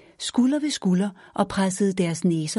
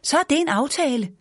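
shouting; 8 semitones higher than usual. A narrator's reading. Mother shouts back while pedaling hard. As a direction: holler it over the wind. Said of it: "råbte mor".

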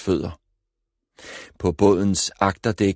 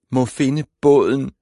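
a high, louder whimper of despair; 6 semitones higher, 5 dB louder than usual. A narrator's reading. Lost girl whimpering to herself on the dock. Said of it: "klynkede hun".

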